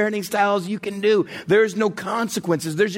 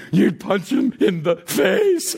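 put-on small child; slower than usual. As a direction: answer dad like a dutiful toddler. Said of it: "he's two and a half".

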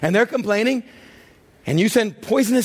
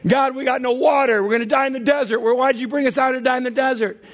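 complaining Israelites; 7 semitones higher than usual. Retold or imitated. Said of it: "in chapter twenty".

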